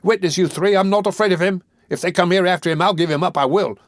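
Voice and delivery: put-on excited boy shouting. A narrator's reading. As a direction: rouse the room, yell for help. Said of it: "becoming more and more excited as he spoke".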